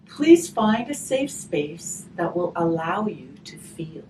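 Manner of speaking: gentle storybook reading, slow and warm; reading to children. A narrator's reading. Teacher reading a grief picture book to children, gentle storytelling.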